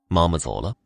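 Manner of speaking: ordinary delivery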